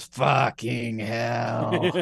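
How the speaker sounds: very slow, growled-out imitation of a gruff footballer; Roy Kent impression. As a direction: drag it out like a grumpy ex-player. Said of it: "she does her best Roy Kent".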